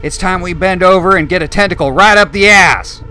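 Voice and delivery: high, loud, colorfully abusive tirade; abusive drill sergeant. A narrator's reading. Drill sergeant berating new recruits on the tarmac, loud and abusive. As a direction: tear into the platoon with contempt.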